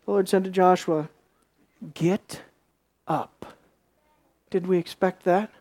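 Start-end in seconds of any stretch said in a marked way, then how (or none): 1.9-3.5 soft, low, with a pause between words; quiet command. Acted. God answering fallen Joshua, quiet grave command. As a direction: give the order quietly and low, word by word.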